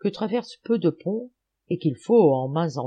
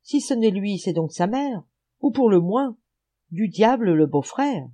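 mild, raised exclamation; exclaimed; 4 semitones higher, 3 dB louder than usual. A narrator's reading. Gerly guessing at the monster's identity, mild exclamation. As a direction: exclaim with a touch of alarm. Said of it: "il s'écria".

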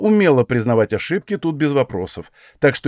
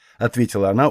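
usual speaking voice

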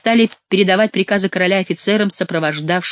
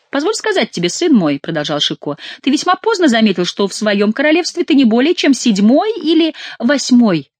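higher, emphatic mock-paternal lecture; mock lecturing; 7 semitones higher, 4 dB louder than usual. A narrator's reading. Chicot tells the king he noticed too late, mock lecturing. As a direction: lecture him with drawn-up, swooping emphasis.

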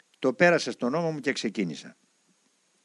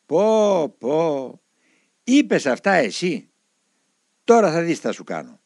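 angry elder; 3 semitones higher, 6 dB louder, slower than usual. Retold or imitated. Elder scolding the disobedient monk.

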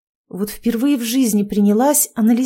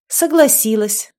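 slow, higher, sighing confession; sighing admission; 4 semitones higher, 4 dB louder, slower than usual. A narrator's reading. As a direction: admit it with a slight sigh. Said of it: "вздохнула". (со вздохом признаваясь)

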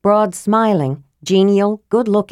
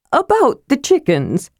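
higher, pestering kender voice; persistent Tass; 9 semitones higher than usual. Acted. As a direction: gently insist on returning to the subject.

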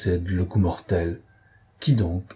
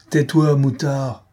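slow, loud, higher angry shout; yelled; 5 semitones higher, 4 dB louder, slower than usual. Acted. Lupin silencing the laughing Beautrelet, a slow angry shout. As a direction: bark each word at the insolent kid. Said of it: "hurla Lupin".

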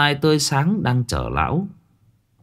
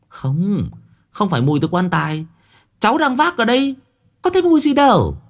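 very high, youthful voice; young pallbearer protesting; 11 semitones higher than usual. A narrator's reading. Young pallbearer denying the smell to the crowd, high and insistent. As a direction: insist it isn't the coffin.

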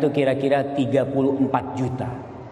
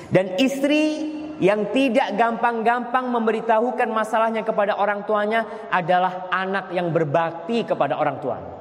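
ordinary delivery

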